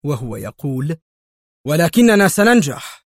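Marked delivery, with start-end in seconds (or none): 1.7-3.1 loud, high insistence; emphatic, fist on the chair; forceful declaration. A narrator's reading. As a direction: slam the claim down. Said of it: "ضرب مايكل مسند مقعده بقبضته".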